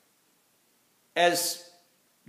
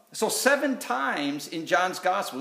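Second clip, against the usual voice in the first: normal talking voice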